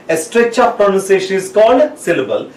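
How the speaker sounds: usual speaking voice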